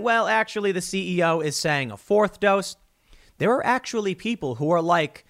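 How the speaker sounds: ordinary talk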